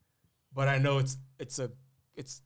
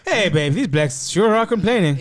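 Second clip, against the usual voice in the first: higher, louder mock complaint to a partner; mock husband griping; 5 semitones higher, 10 dB louder than usual. Co-host mimics a man griping to his partner, loud mock complaint. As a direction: put on a loud, amused griping voice.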